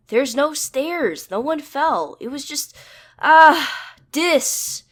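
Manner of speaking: high, loud urgent calling. A narrator's reading. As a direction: call out so they hear across the house. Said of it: "talking to him loudly enough".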